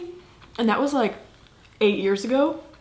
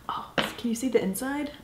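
whispering; 3 semitones higher, 5 dB quieter than usual. Real, not acted. Hushed gasp over sushi.

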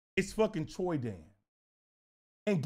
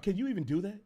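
normal talking voice

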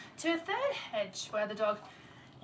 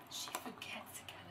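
plain reading voice

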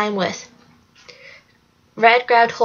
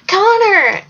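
high, drawn cry after him; desperate high call; yelled; 9 semitones higher, 4 dB louder than usual. A narrator's reading. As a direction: shout the name, pitch leaping up. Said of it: "Alex yelled after him".

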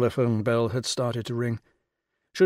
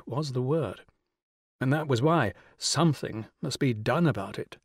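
ordinary delivery, nothing marked

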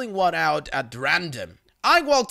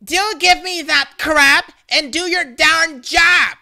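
loud, slow, higher screaming at a store worker; furious yell; 9 semitones higher, 8 dB louder than usual. Retold or imitated. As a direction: scream it like an entitled customer losing it. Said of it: "a woman yelling".

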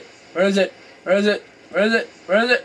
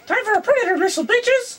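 gloating excited; 9 semitones higher than usual. Real, not acted. Gloating at enemies.

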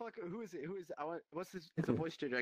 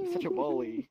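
mock whine, higher; 9 dB louder than usual. Playfully whining at being bullied.

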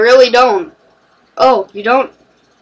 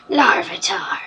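hushed whisper; 3 semitones lower, 6 dB quieter than usual. Pretend. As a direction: breathe the name out in a whisper. Repeats the name for effect.

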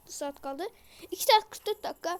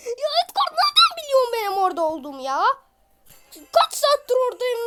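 loud outraged higher voice; indignant shout; 7 semitones higher, 11 dB louder than usual. Real, not acted. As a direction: yell at the enemy in disbelief. Empört über Gegner.

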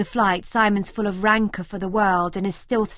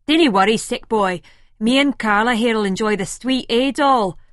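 loud, cocky Scottish man calling out; a brash Scottish lad, much louder; Scottish Terry voice; 2 semitones higher, 7 dB louder than usual. A narrator's reading. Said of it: "Terry says".

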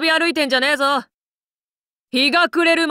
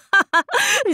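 high burst of laughter; amused laugh. Acted. Man laughing off talk of his old days, amused. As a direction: laugh the remark right off. Laughs off being compared to past.

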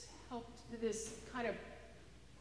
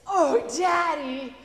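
put-on beam with hope as the happy kid; overjoyed little girl at the punchline; delighted little girl; 8 semitones higher, 10 dB louder than usual. Cheerful girl answers from manure.

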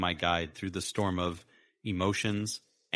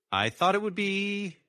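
lightly voiced Sarah; 13 semitones higher, 4 dB louder than usual. A narrator's reading.

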